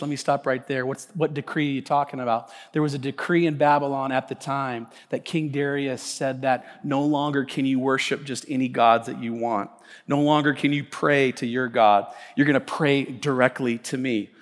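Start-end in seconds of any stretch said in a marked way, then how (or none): none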